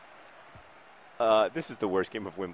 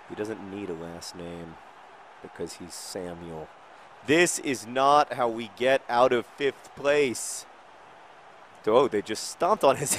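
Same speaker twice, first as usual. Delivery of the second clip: ordinary talk, nothing marked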